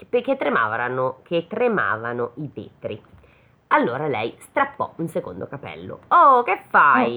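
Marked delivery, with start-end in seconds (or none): 6.1-7.2 loud, slow angry outburst; angry shout. Acted. Devil woken by grandmother, shouting angrily. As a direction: bellow it at her, woken up. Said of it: "gridò il diavolo arrabbiato".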